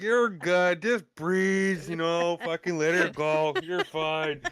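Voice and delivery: imitated cowboy, hollering encouragement; cowboy in next stall. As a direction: coach a puking drunk through the wall. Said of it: "he was a fucking cowboy".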